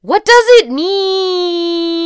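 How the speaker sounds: very high, long drawn-out wail; melodramatic wail. A narrator's reading. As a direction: wail it, stretching the last word right out. Frantic text to sisters, acted.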